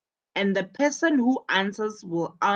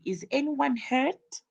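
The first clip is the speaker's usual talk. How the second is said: "Is anyone hurt?" higher with a rise on "anyone"; worried question; 6 semitones higher, 4 dB quieter than usual. Shown as a demonstration. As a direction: ask anxiously, voice going up. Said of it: "in a worried way".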